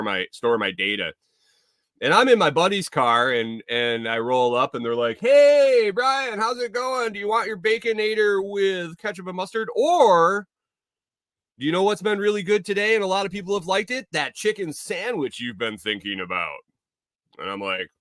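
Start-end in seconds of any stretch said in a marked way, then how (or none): 5.2-16.6 high, overly sweet drive-thru machine; sugary AI voice way up high; creepy friendly AI. Acted. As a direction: upsell him like a too-friendly robot. AI greets him via face scan.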